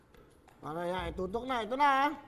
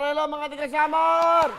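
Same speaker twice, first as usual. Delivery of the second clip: on-air greeting; 5 semitones higher, 8 dB louder than usual. Real, not acted. Host greets the caller's home province on air, showman energy.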